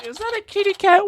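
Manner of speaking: excited squeal. Real, not acted. Spots a cat suddenly.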